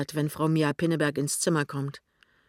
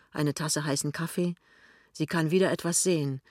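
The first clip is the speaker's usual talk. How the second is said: ordinary delivery, nothing marked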